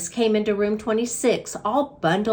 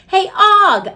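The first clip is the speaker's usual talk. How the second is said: high, loud call across the room; loud hamster call; 10 semitones higher, 8 dB louder than usual. A narrator's reading. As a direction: call out to the frog. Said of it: "I called out".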